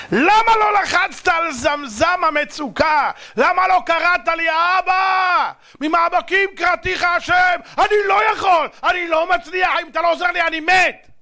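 full-force pleading preacher's shout; impassioned cry. Acted. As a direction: cry out to a father for your life. God rebukes, demands desperate cry for help.

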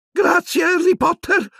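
very high, breathless elf thanks; panting elf. A narrator's reading. Dobby panting thanks to Harry after struggling, very high. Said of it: "ansimò".

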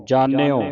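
loud ਜਾਣਿਓ with dental n and glide, "jaan-yo"; model pronunciation. Shown as a demonstration. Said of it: "ਇਸ ਤਰ੍ਹਾਂ ਉਚਾਰਨ ਕਰਨਾ".

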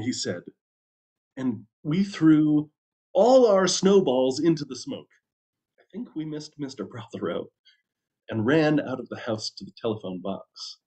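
usual speaking voice

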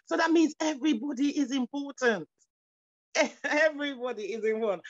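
ordinary talk, nothing marked

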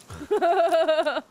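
loud, high burst of laughing; helpless laughter. Real, not acted. Streamer cracks up over a mispronounced word, helpless laughter. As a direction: crack up loudly.

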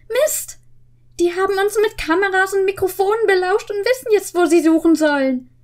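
high, fast panicked outburst; scared boy realizing they were overheard; alarmed. Acted. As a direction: rattle it off in a fright. Peter alarmed, reading story.

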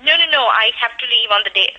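usual speaking voice

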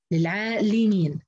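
للعالمين slowly, with li before meen; slow للعالمين down, i on its lam; kasra on the lam. Shown as a demonstration. Teacher repeating Hafs's reading, lam with i. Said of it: "انفرد حفص بكسر اللام".

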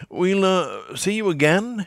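Haines's voice. A narrator's reading. Haines's parting line.

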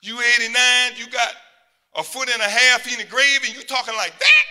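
loud, high, disbelieving scold; incredulous shout. Real, not acted. Preacher scolding old man, shouting.

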